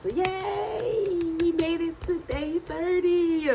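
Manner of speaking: high, slow cheer through a worn-out voice; a weary but happy yay; tired cheer. Real, not acted. Celebrating day thirty to her viewers, slow high cheer. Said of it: "I'm so ecstatic".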